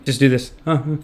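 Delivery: normal talking voice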